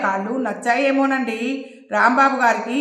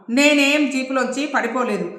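ordinary delivery, nothing marked

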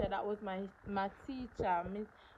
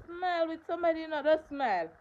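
urging smile; 7 semitones higher, 6 dB louder than usual. Real, not acted.

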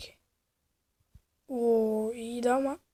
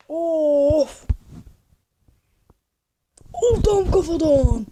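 loud, higher, slowed cry of shock; wincing outburst; 4 semitones higher, 8 dB louder, much slower than usual. Real, not acted. Vlogger watching a head-first crash, loud shocked cry. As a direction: yell it as the rider takes it to the head.

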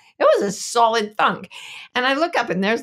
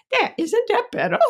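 put-on high, proud little girl; toddler voice; 13 semitones higher than usual. Mom retells daughter finishing the hairdo, high proud child voice. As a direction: show off your handiwork sweetly. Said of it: "and she's like".

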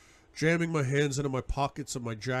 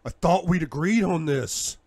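angry; 5 semitones higher, 6 dB louder than usual. A narrator's reading. Angry accusation.

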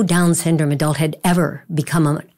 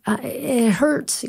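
mild hurt son voice; 8 semitones higher, much slower than usual. Retold or imitated. Chris crying during marathon.

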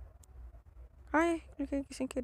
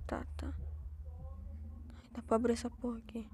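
hushed unvoiced whisper; whispering while hiding; 8 dB quieter than usual. Real, not acted. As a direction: whisper so nothing hears you. Sneaking, crouched in horror game. (sussurrando escondido)

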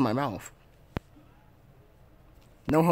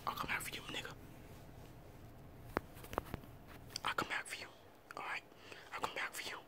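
faint breathy whisper; 23 dB quieter, much faster than usual. Acted. Child whispering to a sibling. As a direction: whisper so Mom can't hear.

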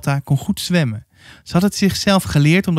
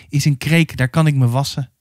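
usual speaking voice

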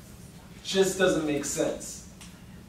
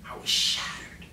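whispering; 4 dB quieter than usual. Real, not acted. Dramatic low confession.

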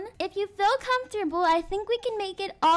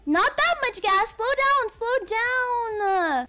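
higher, urgent cries to slow down; acted backseat alarm, pitch jumping up; panicked warning; 4 semitones higher, slower than usual. Parent warning learner who gives too much gas, panicked.

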